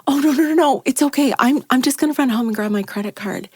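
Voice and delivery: high, loud flustered no no no; flustered refusal. Retold or imitated. Refusing the stranger's offer to pay, flustered and high. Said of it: "And I'm like".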